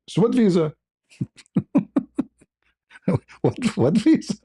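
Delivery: high incredulous so what visa; baffled and laughing at the news; amazed disbelief. Retold or imitated. Telling friends of his stunned reaction at the window, high disbelief.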